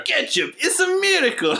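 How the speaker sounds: very high mock Russian; hammy over-the-top accent. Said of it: "I'm saying way, way, way".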